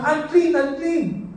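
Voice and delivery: mock shout of unclean. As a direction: call out unclean like a leper warning people. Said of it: "Sisigaw siya".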